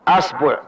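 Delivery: أصبع with a then u, asbuʕ; أصبع, open a start, rounded middle, asbuʕ; asbuʕ with fatha. Shown as a demonstration. Teacher demonstrates the fatha forms, asbuʕ. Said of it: "نأتي بفتح الهمزة".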